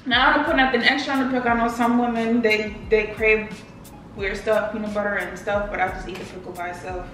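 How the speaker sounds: usual speaking voice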